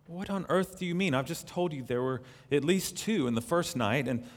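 high-pitched, indignant objection; incredulous protest. A narrator's reading. Frightened boy contradicts the mysterious voice about the lions, incredulous.

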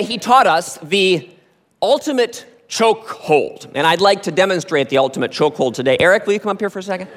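normal talking voice

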